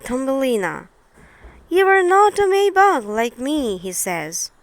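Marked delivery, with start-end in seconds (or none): 1.7-3.8 higher, louder mock-haughty bug; a hint of a snooty beetle; maybug's voice. The maybug telling Thumbelina she doesn't belong, in a lightly voiced haughty way.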